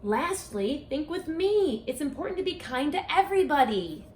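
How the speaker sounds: lively sing-song picture-book read-aloud; reading to children. Acted. Author reading her rhyming book to children, storytime voice. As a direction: read it like storytime for a class of kids.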